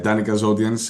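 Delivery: ordinary talk, nothing marked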